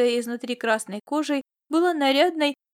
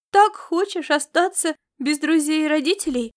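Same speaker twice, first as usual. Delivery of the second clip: raised, higher-pitched outburst; louder, unguarded retort; bursting out; 5 semitones higher, 6 dB louder than usual. Acted. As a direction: let it burst out at the child. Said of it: "вырвалось у Фапиваты".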